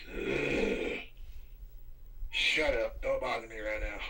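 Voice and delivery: low groan then lightly grumbled brush-off; grumpy. Retold or imitated. Coworkers snapping at a cheerful greeter, mild grumble. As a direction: growl them off half-heartedly. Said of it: "gets up and goes".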